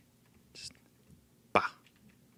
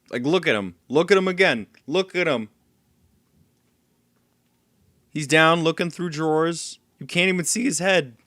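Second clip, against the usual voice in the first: exasperated complaining; 6 semitones higher, 5 dB louder than usual. Real, not acted. Annoyed at enemy's behavior.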